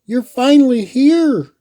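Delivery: high, bright-eyed greeting called out; excited exclamation. A narrator's reading. Sophia greets her favorite aunt, excited and high. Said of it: "exclaimed Sophia".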